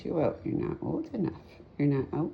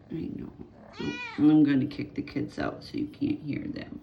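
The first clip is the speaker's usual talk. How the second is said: ordinary talk, nothing marked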